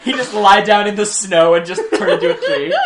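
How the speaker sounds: loud, high, laughing through the words; can barely get the joke out for laughter; cracking up. Real, not acted. Cracking up at own idea.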